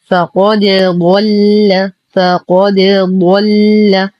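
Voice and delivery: فقد ضل slowly, د bounced clear before ض; bounced dal. Shown as a demonstration. Teacher recites it correctly, slow bounced د in فقد ضل. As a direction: bounce the د of فقد, keep it apart from ضل. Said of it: "نقلقل الدال".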